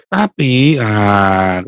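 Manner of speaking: ordinary delivery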